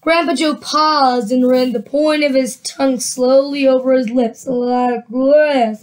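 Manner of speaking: plain reading voice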